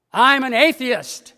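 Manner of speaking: loud, high, slow declaration; emphatic outburst from a storied character. A narrator's reading. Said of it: "shaking his finger emphatically".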